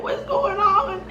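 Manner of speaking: high, slow, imitation of a startled grandma; bewildered grandma. Grandma walking in on the commotion, high bewildered voice.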